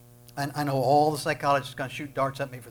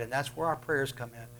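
ordinary talk

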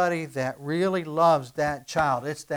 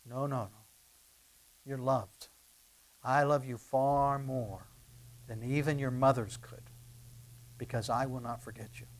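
tender reassurance; 7 semitones lower, 7 dB quieter than usual. Acted. Preacher voicing God to his hearers, soft and tender. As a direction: say it gently, like a promise.

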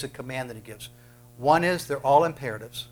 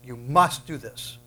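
ordinary delivery

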